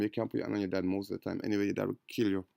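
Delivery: rapid, low-volume joke; quick aside. Real, not acted. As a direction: toss it off quickly under the breath. Fast quiet joking aside.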